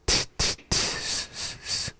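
t, t and s, s as bare voiceless sounds, one by one; separate sounds. Shown as a demonstration. Teacher isolating the ending sounds, soft separate t and s noises. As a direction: tap out each t, then hiss each s softly. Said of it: "make that distinction between the sounds".

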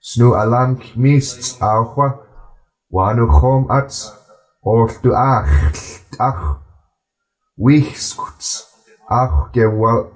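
unhurried storytelling read-aloud; slow careful reading. Shown as a demonstration. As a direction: read it slowly in a gentle even flow for learners to follow. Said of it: "I'm gonna read it a bit slower".

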